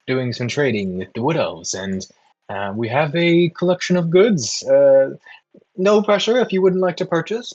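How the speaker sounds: high-pitched merchant introducing the caravan; caravan trader, salesman's pitch. Acted. Trader offers goods to passing adventurers, in a high sales voice.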